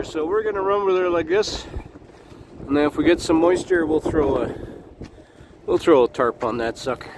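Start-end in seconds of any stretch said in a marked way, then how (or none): none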